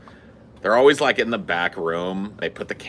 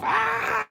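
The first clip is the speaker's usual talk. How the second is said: mock scream. Chick runs off.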